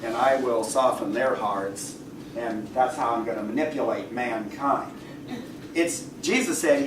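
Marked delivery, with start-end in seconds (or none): 0.0-4.9 lightly voiced God, lower and softer; a hint of a detached God decreeing from above; God looking down. God passing judgment on people's hearts, low and subdued. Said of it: "business of God looking down upon certain people and say".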